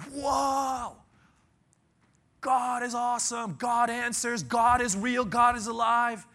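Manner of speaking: high, loud cry of amazement; a crowd shouting praise, amazed by the fire; excited shout. Acted. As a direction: cheer it like a stunned crowd. Crowd cheering at fire from heaven.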